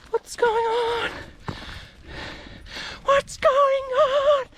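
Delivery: high, slow puzzled dog voice; be the dog who doesn't get it; mock bewildered pup; confused dog.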